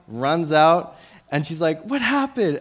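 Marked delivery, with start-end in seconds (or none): 1.8-2.6 high-pitched retold cry of alarm; mère alarmée, imitée. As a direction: voice a mom's startled question, pitched way up. Mother runs out alarmed at crying.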